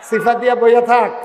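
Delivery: loud, high shouted order; calling out. Real, not acted. Preacher telling someone to sit tight, loud call. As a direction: call sharply across the crowd.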